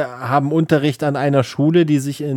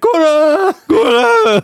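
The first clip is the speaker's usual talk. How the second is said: high, loud monster screech; undead scream; 14 semitones higher, 5 dB louder, slower than usual. Retold or imitated. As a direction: screech like a risen corpse. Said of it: "aus dem Sarg und schreit". (hoher, lauter Zombieschrei)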